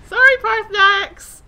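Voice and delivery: high, very loud call of sorry; shouted apology. Real, not acted. Gamer who hit the wrong dragon, yelled apology. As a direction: yell an apology across the battle.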